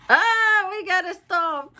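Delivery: high, laughing "ah" as time runs out; startled to see the time; sudden realization. Real, not acted. Reader notices time is up.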